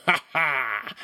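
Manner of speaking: coarse ha ha laugh; mocking laugh. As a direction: laugh at him, jeering. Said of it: "the oaf laughed".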